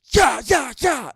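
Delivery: imitating the comic scaring a bear; comedian's yell. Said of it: "the guy just goes".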